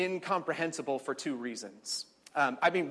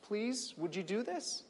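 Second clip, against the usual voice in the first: soft plea; 6 semitones higher, 7 dB quieter, slower than usual. Acted. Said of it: "God says".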